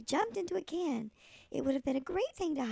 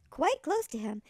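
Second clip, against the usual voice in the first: ordinary delivery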